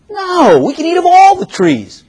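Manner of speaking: high, eager woman's reply; Eve answering. Retold or imitated. Eve answering the serpent, high and eager. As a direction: answer brightly, sure of yourself.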